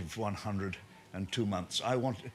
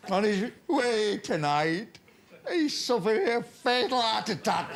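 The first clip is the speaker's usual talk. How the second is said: tearful club secretary; 7 semitones higher than usual. Retold or imitated. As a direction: announce the chairman's death like a grieving club secretary, hammed up. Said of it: "He said".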